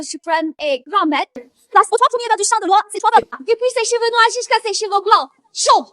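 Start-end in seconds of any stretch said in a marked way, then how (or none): 1.7-5.9 high-pitched, insistent protest; indignant defence. Acted. Daughter defends her father's title to a doubter, indignant. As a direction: pile up the proof in indignation. Fille défend son père, indignée.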